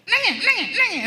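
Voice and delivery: high, loud mock granny scold; scolding grandmother. Grandmother scolding crying grandchild, high mock scold. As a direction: snap it like a cross granny. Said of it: "Cuba tengok opah dia".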